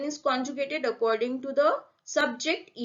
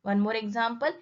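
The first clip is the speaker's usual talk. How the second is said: ordinary talk, nothing marked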